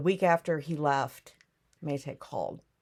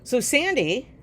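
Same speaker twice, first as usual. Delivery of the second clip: ordinary talk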